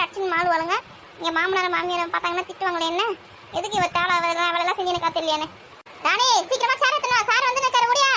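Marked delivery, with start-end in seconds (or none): 6.1-8.1 higher-pitched hurried call. Acted. Woman summoning Rani with a chair for sir, calling out. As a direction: call across to someone further off.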